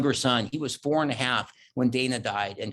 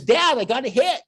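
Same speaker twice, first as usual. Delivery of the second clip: imitating a proud little boy, higher and louder; proud kid; 9 semitones higher, 7 dB louder than usual. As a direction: play the beaming son who thinks he did great. Son proudly reports bunt hit.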